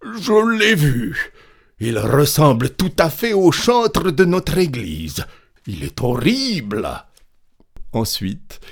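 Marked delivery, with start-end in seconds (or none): 0.0-6.9 high, loud peasant aghast at a sight; horrified exclamation. A narrator's reading. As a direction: cry out in horror as you jump back. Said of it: "s'écria le paysan en faisant un bond". (exclamation horrifiée)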